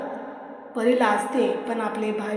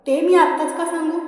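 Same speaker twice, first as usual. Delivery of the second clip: playful tease; 6 semitones higher, 5 dB louder than usual. A narrator's reading. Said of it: "लाडात येऊन".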